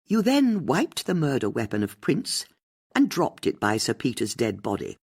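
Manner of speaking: ordinary delivery, nothing marked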